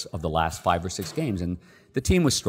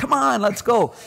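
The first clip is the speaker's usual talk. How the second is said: much higher, rowdy mimic of bar buddies; buddies on the phone; 4 dB louder, slower than usual. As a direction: play the guys yelling down the line.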